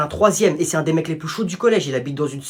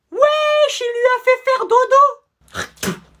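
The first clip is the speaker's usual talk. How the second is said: high, slow, loud amazed cry; amazed hype; 5 dB louder, much slower than usual. Acted. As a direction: hype up the knockout. Kid amazed by knockout.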